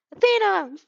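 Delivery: loud high call across the street. A narrator's reading. Boy calling his friend's name from the stairs, loud and high. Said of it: "he calls".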